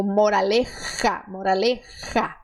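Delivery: moraleja with a strong raspy J. Shown as a demonstration. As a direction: force the j in moraleja. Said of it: "pra colocar força".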